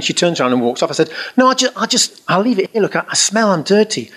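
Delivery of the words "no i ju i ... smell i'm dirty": high-pitched, stammering excuse; acted fluster, halting and high; flustered protest. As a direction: beg off awkwardly in a raised voice. Acted flustered protest to departing nun.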